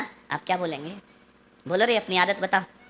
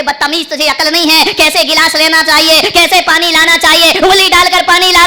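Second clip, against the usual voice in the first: louder rising scold; father berating his son, louder; scolding parent; 5 semitones higher, 7 dB louder than usual. Retold or imitated. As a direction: tell off the child for the glass. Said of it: "आप कहते".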